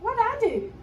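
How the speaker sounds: put-on high, loud child's protest; offended little girl, pitched high; hurt surprise. A narrator's reading. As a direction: squeak out the hurt question.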